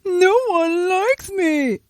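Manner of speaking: slow, high hammed-up whimper; mock crying. Namor mocked by Aquaman, hammed-up crying. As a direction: whine it like a sulking child. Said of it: "Namor cries".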